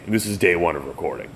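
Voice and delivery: ordinary delivery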